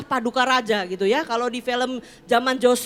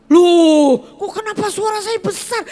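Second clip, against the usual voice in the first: loud, higher surprised exclamation; 7 semitones higher, 11 dB louder than usual. Retold or imitated. Employee surprised by boss's trivial remark, loud and higher. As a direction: react loudly, thrown off.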